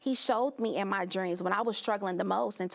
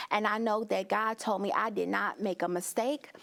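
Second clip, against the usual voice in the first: ordinary talk, nothing marked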